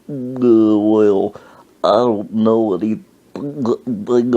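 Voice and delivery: put-on slow, labored old-man voice; mock elderly man groping for words; old man's halting talk. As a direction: drag each phrase out with long gaps. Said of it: "That's how he talked".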